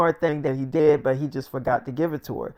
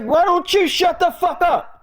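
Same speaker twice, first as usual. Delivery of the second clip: high, exasperated retold yell; very high, heated demand; angry outburst; 13 semitones higher, 4 dB louder than usual. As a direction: yell it at a friend who won't stop. Acted order to shut up.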